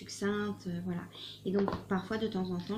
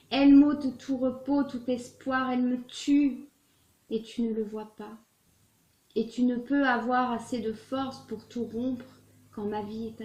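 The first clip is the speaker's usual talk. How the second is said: impassioned, pleading love-letter recital; earnest theatrical reading with swelling emphasis; dramatic letter reading; 2 semitones higher, 3 dB louder than usual. Acted. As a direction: read it as a heartfelt plea to a lover. Reads a love letter aloud.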